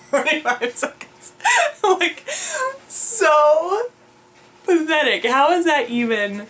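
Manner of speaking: laughing at herself. Real, not acted. Vlogger laughing at her own weak first run, laughing. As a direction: laugh through the words in disbelief.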